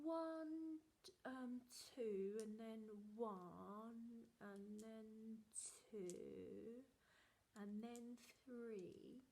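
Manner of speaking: slow, quiet, fumbling counting; a guilty child stalling for time; hesitant boy. Retold or imitated. Brother stalling while counting the chocolates, slow and quiet. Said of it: "he was like this".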